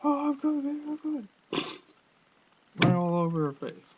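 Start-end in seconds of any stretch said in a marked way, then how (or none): 0.0-1.3 hammed-up porn moan, squeaky high; porn star moan. Mocking a porn actress crying out in a much higher voice. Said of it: "they sit there and".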